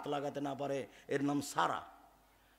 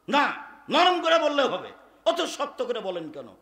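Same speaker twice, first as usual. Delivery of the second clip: louder and higher, slowed at the start; loud insistence; 8 semitones higher, 10 dB louder than usual. Retold or imitated. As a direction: push back loudly. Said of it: "বলছে খুব জোরে".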